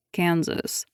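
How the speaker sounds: a single heavy word, gravely. Acted. Otto correcting Emily about the murders, gravely. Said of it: "Otto says gravely".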